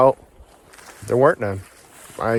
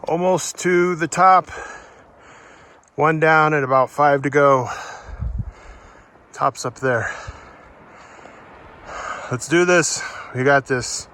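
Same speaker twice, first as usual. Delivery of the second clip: winded, higher voice with long breathing pauses; catching breath; 4 semitones higher than usual. Real, not acted. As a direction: puff short phrases out on a real climb. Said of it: "Catch my breath first".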